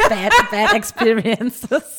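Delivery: laughing through the words; slow, giggling confession. Real, not acted. Laughing at her own bad luck.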